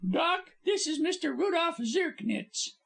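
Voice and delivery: high, bright stage star voice; lively actress. Acted. Actress Polly babbling to her visitors, high. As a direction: chatter on like a cheerful star.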